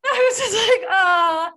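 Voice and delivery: laughing through the words. Real, not acted. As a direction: crack up while talking. Laughing at own mistake.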